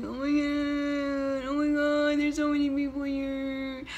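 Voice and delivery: mock overwhelmed gasp; nervous panic. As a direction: fret at the crowd. Panicked inner voice.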